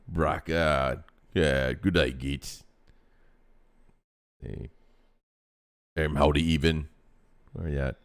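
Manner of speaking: low, gruff orc voice. Acted. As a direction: read it like a big dumb orc. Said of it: "be read in an orc voice".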